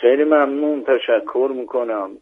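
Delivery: ordinary talk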